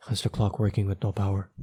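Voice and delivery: ordinary delivery, nothing marked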